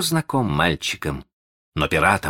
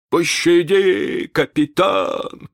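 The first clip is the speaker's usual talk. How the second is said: whimpering; 11 semitones higher, 4 dB louder, slower than usual. Acted. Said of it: "захныкал Старки".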